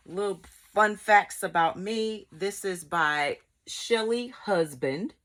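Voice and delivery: usual speaking voice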